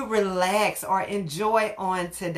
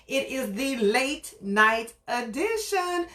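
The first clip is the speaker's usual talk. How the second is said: show host intro; 3 semitones higher, slower than usual. Acted. Host's drawn-out show intro.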